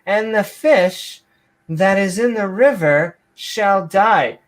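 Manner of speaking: hammy, solemn King James declamation; mock-biblical reading. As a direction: intone the plague like grand scripture.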